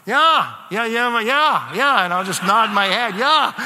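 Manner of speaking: high, loud bluffing yeahs; mock eager yeahs. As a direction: nod along pretending to understand. Pretending to follow fast German.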